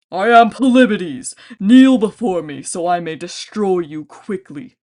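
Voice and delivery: loud, slow booming threat; menacing giant. A narrator's reading. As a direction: proclaim yourself like a tyrant.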